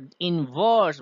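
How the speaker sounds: "in verse" with "verse" drawn out long; short "in", then a slow, stressed "verse". Shown as a demonstration. Teacher marking the iambic foot, "verse" stretched in "in verse". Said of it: "ভার্সে stress পড়ছে".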